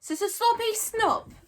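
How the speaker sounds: high, slow stammering dragon jeer. A narrator's reading. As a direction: jeer at her. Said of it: "jeered Toothless".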